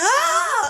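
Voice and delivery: high laughing mock shriek; mock scream. Retelling Sandra Oh hit by a jeep, mock shriek. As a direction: yelp like she just got hit.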